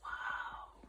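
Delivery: breathy whispered wow; awed whisper. A narrator's reading. Narrator amazed by the magic horn, whispering. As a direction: whisper it in wonder. Awe at glitter scribbles.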